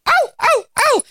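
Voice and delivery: high little yipping whimper; squeaky imitated dog whimpers; puppy yips. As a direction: yip like a tiny hopping puppy. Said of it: "he goes".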